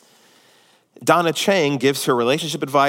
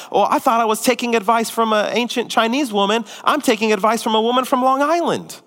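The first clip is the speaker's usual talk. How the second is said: mock indignant rant, pitched higher; hammed-up offended mother, high; losing it; 8 semitones higher than usual. George's mom explaining why she's leaving, mock indignant. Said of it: "George's mom loses it".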